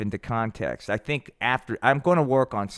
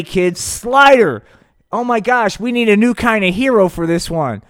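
ordinary delivery

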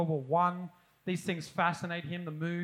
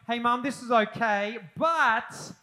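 picky diner; 4 semitones higher, 7 dB louder than usual. Retold or imitated. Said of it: "sitting down at home and going".

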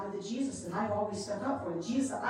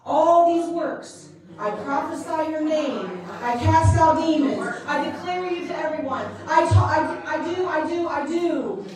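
loud, slowed insistent listing of deeds; loud defensive pleading; loud pleading protest; 5 semitones higher, 10 dB louder, slower than usual. Retold or imitated. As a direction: protest loudly, listing each deed. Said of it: "what I just do?".

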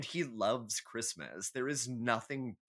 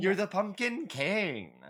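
higher, louder and slower, a hammed-up royal title; mock grand proclamation; 10 semitones higher, 6 dB louder than usual. Mocking Jack Skellington to her cohost, drawn out high and loud. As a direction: crown him pumpkin king with a high, loud, drawn-out flourish. Echoing pumpkin king mockingly.

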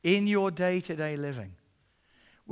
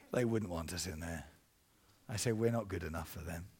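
ashamed, downcast; 11 semitones lower, 10 dB quieter than usual. Retold or imitated. Teens ashamed, looking down.

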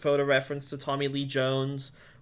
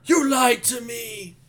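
very high, slow, drawn-out shout; mock screaming; 13 semitones higher, 7 dB louder, slower than usual. Co-host joins in mocking the screaming character, hammed-up shout. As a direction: ham up a melodramatic accusing scream. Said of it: "the one that's gonna be screaming".

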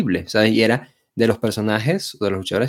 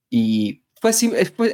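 ordinary talk, nothing marked

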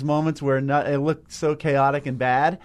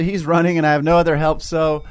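normal talking voice

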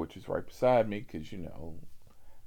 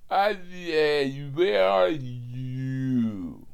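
put-on slow, slurred drunk voice; drunk customer voice; 4 semitones higher, 4 dB louder, much slower than usual. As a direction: drag each word like a drunk.

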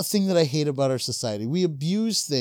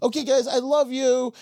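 put-on teen girl voice; 8 semitones higher, 3 dB louder than usual. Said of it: "She was like".

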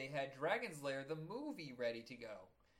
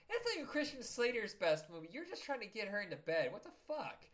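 mock indignant retort; 6 semitones higher, 3 dB louder than usual. Retold calling out a friend.

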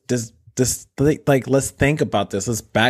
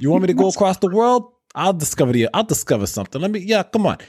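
brash Brooklyn tough guy; Brooklyn accent; 7 semitones higher than usual. Retold or imitated. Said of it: "with his Brooklyn accent".

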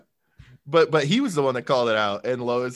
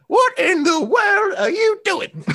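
slow, loud, squeaky falsetto; drawn-out shrieky granny imitation; mock old woman; 7 dB louder, slower than usual. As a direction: parody a scandalized old lady, very high. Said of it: "when you pretend to be a woman".

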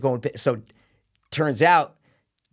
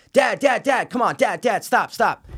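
higher, repeated calls imitating a kid; pleading son; 8 semitones higher than usual. Young son pleading with father to stop, urgent. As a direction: beg Dad to stop, pitched up.

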